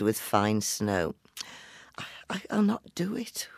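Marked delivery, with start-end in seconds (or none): 2.3-3.5 stammering frightened whisper, higher; trembling whisper. Acted. Mary whispers her refusal, trembling with fear. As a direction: barely breathe it, trembling. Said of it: "whispered Mary, trembling".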